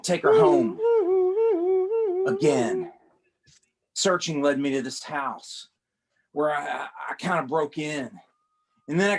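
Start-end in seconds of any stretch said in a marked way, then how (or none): 0.0-2.9 much higher, a cry of despair on again; exasperated wail. Acted. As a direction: whine the last word in mock agony. Frustrated narrator wails again.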